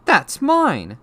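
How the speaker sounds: put-on high, loud boy's protest; indignant protest. A narrator's reading. Boy snatching back his letter, loud indignant cry. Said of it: "trying to snatch it back".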